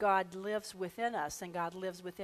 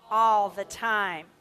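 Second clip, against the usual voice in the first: slow chanted affirmation swelling louder at the end; slow group affirmation; 8 dB louder, much slower than usual. Acted. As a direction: draw it out, then swell on all the time. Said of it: "let's affirm this together".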